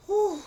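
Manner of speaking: high-pitched "whew" of admiration; swooning whew. Real, not acted. Fan swooning over Mister Russell, high whew. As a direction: let out a hot-and-bothered whew. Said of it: "I just had to fan myself".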